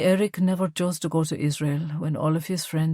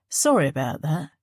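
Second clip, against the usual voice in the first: put-on light Cockney accent, falsely friendly; lightly voiced Cockney crime boss, matey on the surface; Cockney gangster. A narrator's reading. Crime boss chats to Fiach in the car in a light Cockney accent. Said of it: "His accent was pure Cockney".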